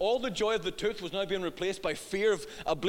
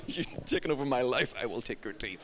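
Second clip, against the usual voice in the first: low, quiet mock-menacing threat; hammed-up low threat over a loose tooth; mock menace; 10 semitones lower, 5 dB quieter than usual. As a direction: growl it out like a pantomime villain. Dad voicing tooth payback threat.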